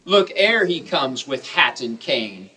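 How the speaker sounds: ordinary talk, nothing marked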